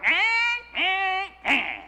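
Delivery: high mock nagging "eh, eh, eh"; mock nagging grunts. Pastor mocking Michal's complaint with nagging grunts. Wife coming out to nag.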